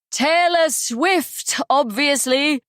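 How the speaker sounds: high, slow exasperated cry; cried out. Acted. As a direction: stretch it out in mock despair. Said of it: "he cried".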